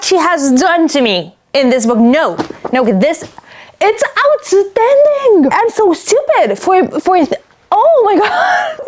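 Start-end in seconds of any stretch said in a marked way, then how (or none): 2.0-8.8 high, shouting disbelief; overwhelmed by the twist; stunned. Real, not acted. Reader stunned by the plot twist, shouting in disbelief.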